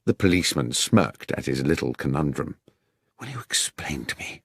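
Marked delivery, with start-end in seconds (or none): 3.2-4.4 hushed, urgent whisper. Acted. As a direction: keep it under your breath. Doctor whispering in hiding.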